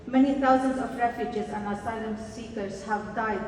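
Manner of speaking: usual speaking voice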